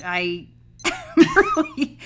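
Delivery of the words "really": laughing. Real, not acted. Laughing while complaining.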